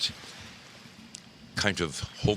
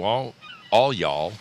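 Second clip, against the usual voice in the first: louder, drawn-out Texan all y'all; 3 semitones higher, 6 dB louder, slower than usual. Shown as a demonstration. Host showing chat the Texan way to say it, louder. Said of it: "is plural possessive if you are a Texan".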